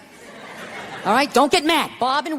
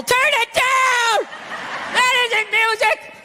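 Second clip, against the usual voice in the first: put-on slow, higher grumpy old man shouting; mock old man yell; 10 semitones higher, much slower than usual. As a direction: yell up at the noisy neighbours like a cranky old man. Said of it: "just screaming up at the younger couple".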